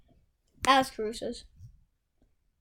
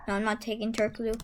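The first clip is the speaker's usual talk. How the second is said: normal talking voice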